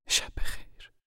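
whispered good night; quietly. Acted. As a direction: breathe a soft good night. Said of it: "زن آرام گفت".

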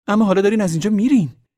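fast, high, loud protest; heated yell, racing through it; angry shout. A narrator's reading. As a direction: hurl the accusation at him in a rush. Girl shouts in quarrel.

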